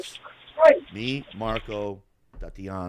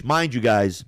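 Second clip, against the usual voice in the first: usual speaking voice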